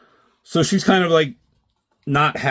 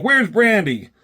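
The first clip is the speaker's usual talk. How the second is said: raised, higher pitch for the man asking; man's demanding voice; 10 semitones higher, 4 dB louder than usual. Retold or imitated. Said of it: "And he's like".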